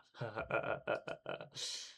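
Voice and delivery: airless half-laugh while running; a helpless laugh with no breath behind it; exhausted hysterical laugh; breathless laugh. Acted. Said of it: "but I begin to laugh".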